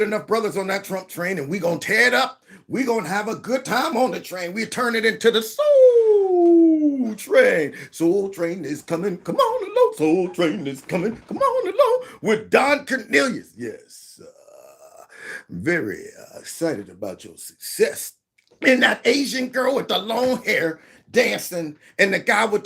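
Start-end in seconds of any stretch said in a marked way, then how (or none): 4.5-13.5 lightly voiced TV dance show host; Soul Train announcer. Hosting Soul Train bit. 13.6-18.1 much lower, soft, put-on host voice; low, velvety mock TV host voice; deep mock host voice. Soul Train host voice.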